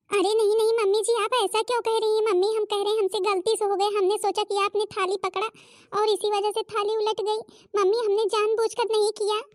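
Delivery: high, pleading apology; anxious pleading. Acted. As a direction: beg her to believe it was an accident. Daughter-in-law pleading it was an accident. (घबराकर माफ़ी माँगती बहू)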